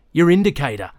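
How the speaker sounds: a touch of rural Australian farmer, said seriously; serious farmer's accent. A narrator's reading. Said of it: "said seriously in his farmer's accent".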